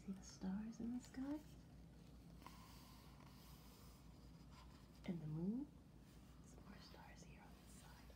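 put-on soft, hushed storytelling for sleepy kids; bedtime whisper. Telling children the animals sleep, a hushed whisper. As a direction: lull them toward sleep.

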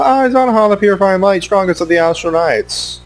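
grand boastful knight voice; boastful villain. Pretend. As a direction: announce yourself with pompous pride.

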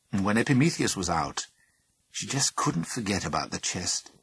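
usual speaking voice

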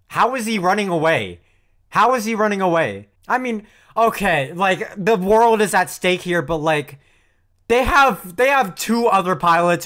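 loud, high, worked-up defense; heated rant. Real, not acted. Viewer defending Shinji against critics, heated rant. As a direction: argue his side, all fired up.

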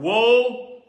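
normal talking voice

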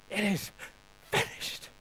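It is finished breathed out as a whisper, voice dropping away. Shown as a demonstration. Preacher repeating the words for the congregation, whispered. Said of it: "putting your past behind you and saying".

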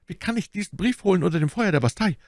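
high, fast bandit voice; anxious high-pitched question; desperate protest. Acted. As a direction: object in fear of being shot. Terrified bandit protests deadly errand.

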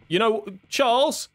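high, nervous plea to the neighbor; calling out for help, spooked; high frightened call to Charles; real scared. Real, not acted. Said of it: "I'm pretty scared".

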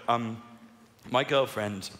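ordinary talk

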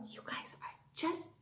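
soft whisper; whispered praise. Real, not acted. Teacher praising the kids in a whisper.

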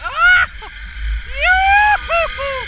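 very high, loud whoops; joyful screaming. Real, not acted. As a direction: scream with glee on the ride. Joyful screams during the ride.

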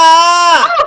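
loud, high yelp; frustrated scream. Real, not acted. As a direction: scream out in dismay. Screaming back amid lost race. (속상해서 꽥 지르는 비명)

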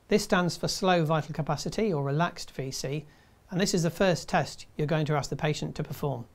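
ordinary delivery

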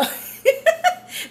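high, rising burst of laughter; real laugh. Real, not acted. Woman laughing at her mom story.

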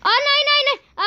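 high, alarmed burst of no's; panicked cry. Real, not acted. About to fall in game. (घबराकर ऊँची आवाज़ में)

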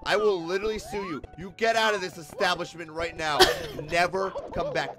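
mock angry clerk. Clerk throwing the dumb customer out of the store, mock furious. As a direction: play the clerk banning a customer, hammed-up. Clerk voiced banning him.

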